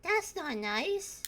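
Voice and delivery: put-on a child gently working it out; slow, earnest small boy; Rad the kid. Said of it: "and he goes".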